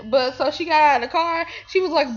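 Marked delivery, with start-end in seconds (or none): none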